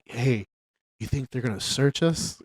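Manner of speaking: soft, low stoned voice; stoned mumble. Retold or imitated. Quoting the stoned recruit to friends, soft dazed mumble. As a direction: speak it soft and dazed like you're high. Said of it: "he's high as fuck".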